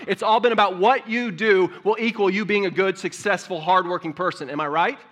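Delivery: loud, rising preacher's cry, building to a peak; preaching at full force. Acted. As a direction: preach it hard to the church.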